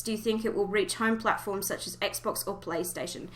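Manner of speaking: ordinary delivery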